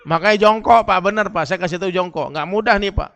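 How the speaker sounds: preacher insists to the men that they squat; emphatic insistence. Acted. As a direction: drive the point home.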